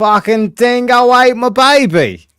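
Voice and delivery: put-on Australian accent. Aussie bit continues.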